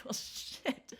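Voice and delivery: breathy under-the-breath shit; resigned muttering to herself; muttered curse. Retold or imitated. Teen on a hike, resigned whisper. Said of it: "And I'm like".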